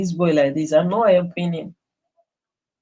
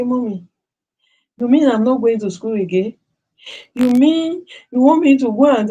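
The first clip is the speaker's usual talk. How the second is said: put-on higher, disbelieving teen voice; pleading son; 6 semitones higher than usual. Son asking his mother if school is over, higher and disbelieving. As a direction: play the hurt boy asking mommy.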